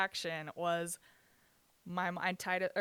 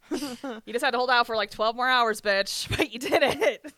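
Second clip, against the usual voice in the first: loud, higher rant breaking into a laugh; exasperated outburst; 4 semitones higher, 10 dB louder than usual. Acted. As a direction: scold an absent mother, half laughing. Ranting at absent mom, laughing.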